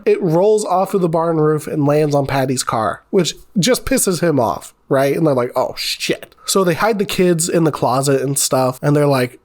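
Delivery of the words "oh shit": breathy unvoiced whisper of dread; whispered oh shit. Family trapped, realizing they angered Patty, whispered in dread. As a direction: hush it like you've just been caught. Molotov lands on Patty's car.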